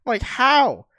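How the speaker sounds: rising outraged disbelief, slow then shouted; a kid who can't believe the loss; loud stunned how; disbelieving outcry. Retold or imitated. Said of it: "and I go".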